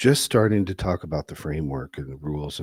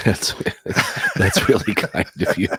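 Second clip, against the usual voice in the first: laughing while thanking sarcastically; real amused laughter; 4 dB louder than usual. Real, not acted. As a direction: talk through laughter, then laugh. Laughing at cohost's teasing joke.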